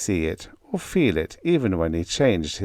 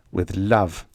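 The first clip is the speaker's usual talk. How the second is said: ordinary delivery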